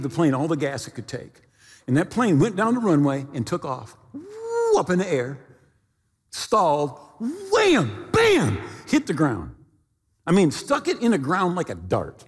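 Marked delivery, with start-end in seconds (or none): 4.1-4.8 whoosh of takeoff. Retold or imitated. Plane takes off, imitated. 7.5-8.1 crash sound. Retold or imitated. Plane stalls and hits ground.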